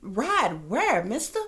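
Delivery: pitched high in disbelief; high, outraged disbelief; incredulous. Real, not acted. Outraged at officer's request.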